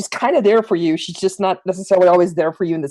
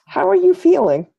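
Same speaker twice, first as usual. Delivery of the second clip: Reina asking; 9 semitones higher than usual. Acted. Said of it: "I say".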